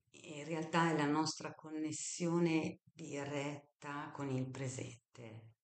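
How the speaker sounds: ordinary talk, nothing marked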